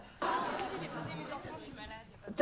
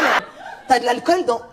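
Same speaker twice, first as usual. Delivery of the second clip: ordinary talk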